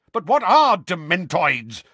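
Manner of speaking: loud, high, slow furious question. A narrator's reading. Uncle baffled by a strange word, furious. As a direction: demand it, dragging out the words. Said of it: "asked Uncle Vernon furiously".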